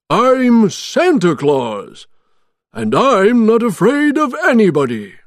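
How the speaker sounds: loud, high, proud declaration; big storybook Santa voice; defiant Santa. A narrator's reading. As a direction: declare yourself boldly to your captor. Santa defies his captor.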